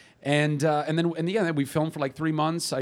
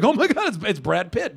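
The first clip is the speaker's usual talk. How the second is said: excited fans; 7 semitones higher, 5 dB louder than usual. Retold or imitated. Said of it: "they're like".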